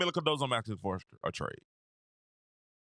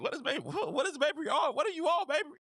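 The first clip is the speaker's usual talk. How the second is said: incredulous, much higher voice; mock disbelief; 9 semitones higher than usual. Streamer mocking a player's bad shooting, higher incredulous voice. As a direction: ask it as if you can't believe the shooting. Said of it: "I'm like".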